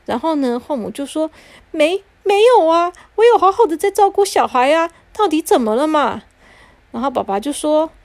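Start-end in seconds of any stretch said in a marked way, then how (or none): 1.7-6.2 high, flustered, defensive voice; startled stepmother. Acted. Stepmother flustered as her husband accuses her. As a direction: caught off guard and stammering excuses. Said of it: "后母就有点吓一跳".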